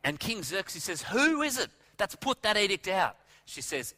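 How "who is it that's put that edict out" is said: higher, mock-outraged question; indignant demand. King Xerxes asks Esther who did it, acted indignation. King hears of deadly edict.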